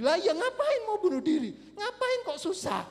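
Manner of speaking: loud, high mock outburst; incredulous exclamation. Preacher retells scoffing at suicide over breakup, loud incredulity. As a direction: shoot the pitch right up, scoffing loudly. Retold exclamation about needless despair.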